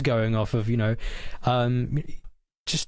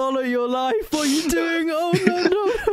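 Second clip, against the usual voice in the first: high, wailing mock anguish with a laugh; mock wailing despair. Mocking a crypto holder's losses, high wailing mock despair. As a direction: wail as if watching your savings vanish.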